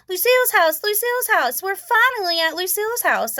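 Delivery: high, eager cry of arrival; child calling out that they've finally arrived; excited shout. A narrator's reading. Girl arriving at a party, shouting with excitement. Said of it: "I shouted out".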